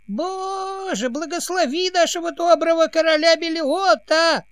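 put-on very high, thin old man's voice; thin quavering old voice. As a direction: quaver it like a frail old advisor. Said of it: "тонким, дребезжащим голоском".

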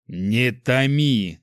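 very slow, drawn-out impatient order; pressing. A narrator's reading. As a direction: press hard, stretching each word. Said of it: "надавил правитель". (с нажимом, нетерпеливо)

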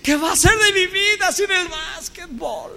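high, wailing, broken sobbing voice; hammed-up teen sobbing that rises to a shout; mock sobbing. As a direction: wail like a crushed teenager. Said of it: "llegar a la casa a llorar".

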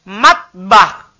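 tongue front at the base of the upper teeth for "Matbah."; teacher models "Matbah." for the class; "Matbah." said slowly with clear articulation. Shown as a demonstration. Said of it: "pangkal gigi atas".

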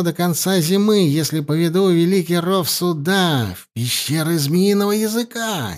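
excited governor. Acted. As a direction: gush like a scheming official.

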